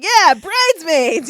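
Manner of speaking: high, loud, drawn-out mock cheer; light bulb suddenly goes on, hammed up; mock excited realization. Retelling his delight at the bridesmaids idea, excited. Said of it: "like the light went off on him".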